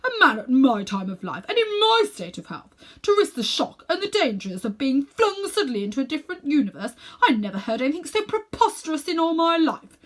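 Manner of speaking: scandalised at the very idea; pompous fury at the boy; outraged uncle. Acted. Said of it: "surprised and offended".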